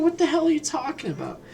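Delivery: put-on high-pitched puzzled kid; imitating young self. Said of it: "I was a kid".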